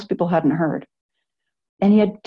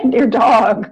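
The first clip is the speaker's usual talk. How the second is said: real laugh breaking through the words; 7 semitones higher than usual. Real, not acted. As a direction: crack up mid-list. Laughs at her own joke.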